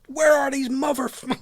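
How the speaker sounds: high-pitched imitation of a sweary yell; mock angry shouting. Co-host imagines Jen snapping and smashing everything, mock shouting. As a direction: act out her raging yell, cut off mid-swear. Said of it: "like, shouting, swearing".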